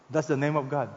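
normal talking voice

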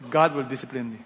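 usual speaking voice

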